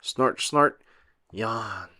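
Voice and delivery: snorts, then a soft yawn; snort, then yawn sleepily. A narrator's reading. Sleepy pig sounds.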